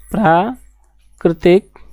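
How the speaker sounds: drawn-out, syllable by syllable for students to write; slow dictation. Shown as a demonstration. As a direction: stretch each syllable so the class can copy it down. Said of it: "क्या होता है?".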